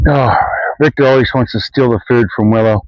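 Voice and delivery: ordinary talk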